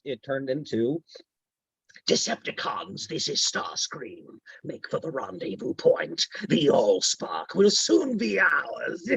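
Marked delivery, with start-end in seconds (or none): 2.1-9.1 breathy, demonic robot commander, slow and menacing; new Starscream voice. Acted. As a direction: hiss the orders like a scheming robot villain. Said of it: "in a moment's notice like this".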